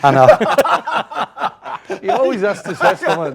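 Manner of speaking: high, loud laughing words. Real, not acted. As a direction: laugh through the line. Laughing at co-host's remark.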